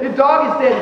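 firmer, livelier retold protest; emphatic, exasperated comeback; insistent retort. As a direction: state it as plain fact, insisting. Insisting when she will not accept it.

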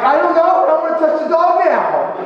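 high, loud retold excitement; worked-up comic comeback; excited reply. Excited reply as dog revives.

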